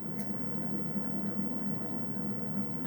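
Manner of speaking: unvoiced, helpless breathy laughter; wheezy laugh. Real, not acted. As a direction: laugh till no voice comes out. Helpless laughter after the quote.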